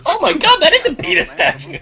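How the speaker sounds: high, laughing disbelief; real shocked laugh at the statue; surprised. Real, not acted. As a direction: squeal it out, shocked and amused.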